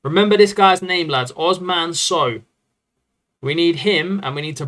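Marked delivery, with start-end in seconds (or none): none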